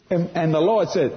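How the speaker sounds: usual speaking voice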